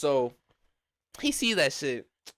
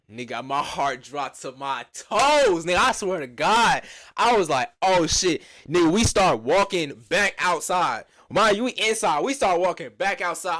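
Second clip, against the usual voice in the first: loud, higher-pitched hyped storytelling; panicked excitement; 4 semitones higher, 7 dB louder than usual. Retold or imitated. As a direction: relive the nervous rush at full volume. Retells the moment his heart dropped.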